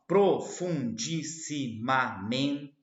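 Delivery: "profundissimamente" bem lento e alto, sílaba por sílaba; silabação. Shown as a demonstration. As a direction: separe "profundissimamente" em sete sílabas, com força. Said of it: "sete sílabas". ("profundissimamente" silabado devagar, destacando cada sílaba)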